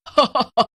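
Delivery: witch's gloating cackle; loud witch laugh. Acted. Witch laughs over her secret trick, loud gloating laugh. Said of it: "बड़ी जोर से हंसने लगती है".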